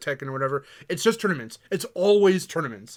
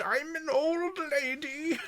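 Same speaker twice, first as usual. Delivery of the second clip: high, slow crone voice, laughing; old witch voice; 10 semitones higher, 7 dB quieter, slower than usual. Retold or imitated. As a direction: mimic a creaky old witch. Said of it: "making her sound like a witch".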